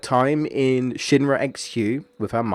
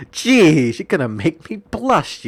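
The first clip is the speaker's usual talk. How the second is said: higher and louder mock bashful Tifa, gushing; Tifa teasing; 7 semitones higher, 5 dB louder, slower than usual. Tifa gushing over Jessie's praise, higher and louder, playfully bashful. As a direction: gush like you're blushing at the compliment. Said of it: "she's like".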